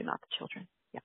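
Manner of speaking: breathy whispered aside, almost no voice. Real, not acted. Mother whispering a joke to the host: hushed aside. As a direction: drop to a whisper for a quick joke.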